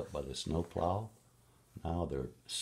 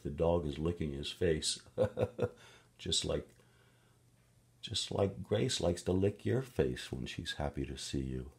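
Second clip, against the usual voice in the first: ordinary delivery, nothing marked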